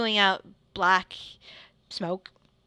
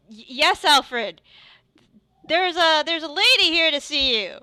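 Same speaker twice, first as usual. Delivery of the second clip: raised, lifted call from another room; Tegan calling back; 8 semitones higher, 6 dB louder than usual. Acted. Tegan calling out to Alfred from the back, raised voice. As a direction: answer loudly from the back.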